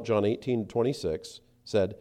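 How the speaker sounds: ordinary talk, nothing marked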